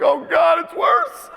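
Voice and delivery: high-pitched wail of comic dismay; hammed-up, high-pitched panic; mock horror. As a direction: squeal it in exaggerated horror, on the edge of laughing. Retells horror at dyed beard.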